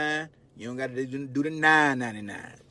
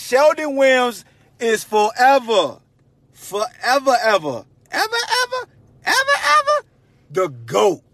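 louder and climbing much higher on each ever; building hype; 9 semitones higher, 10 dB louder than usual. Real, not acted. Host crowning Sheldon the goat, rising squealed evers.

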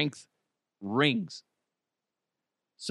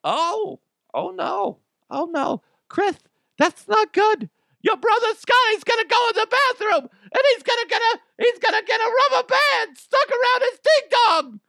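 high, loud fretting mother; hammed-up panicked mom; mock worried mom; 6 dB louder than usual. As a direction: mom fretting about brother Scotty. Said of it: "And I'm like".

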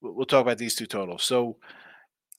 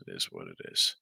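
much lower, a put-on deep voice; mock deep voice; 8 dB quieter, faster than usual. Host shrugging off a mistake, in a mock deep voice. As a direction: drop way down into a comic low voice.

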